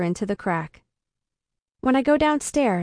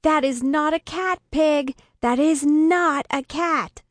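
raised, higher voice, insisting; alarmed protest; 6 semitones higher, slower than usual. A narrator's reading. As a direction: warn her urgently, slowing hard on the repeat. Alarmed narrator argues with Pig.

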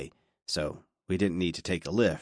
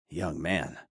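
softer, wry muttered repeat; wry aside; 4 semitones lower, 5 dB quieter than usual. A narrator's reading. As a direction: mutter it back to yourself, wryly.

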